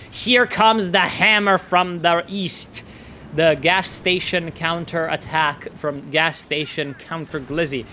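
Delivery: ordinary delivery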